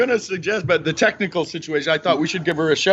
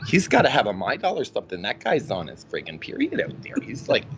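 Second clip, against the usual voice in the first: a hint of his mother, blunt and doting; imitating mom; 4 semitones lower than usual. Son voicing his mom calling Embiid a mess. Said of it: "she goes".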